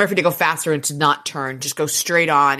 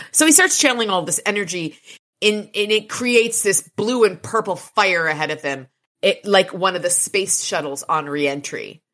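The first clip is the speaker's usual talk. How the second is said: ordinary delivery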